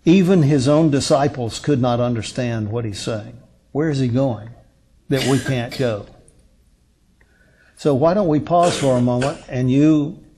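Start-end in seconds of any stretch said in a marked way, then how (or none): none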